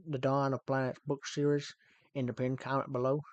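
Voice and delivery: amateur put-on Texan accent; attempted Texas accent. Shown as a demonstration. Streamer reading out lines for chat, attempted Texas accent. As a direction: read it out as a would-be Texan. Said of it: "so I tried to do Texas".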